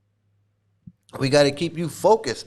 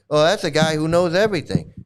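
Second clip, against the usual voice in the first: ordinary delivery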